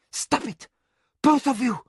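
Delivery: high, sharp command; breaking in. A narrator's reading. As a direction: cut them off sharply. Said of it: "Mara broke in".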